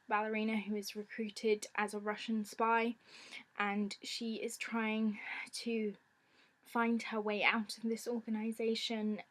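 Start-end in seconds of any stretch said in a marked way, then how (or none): none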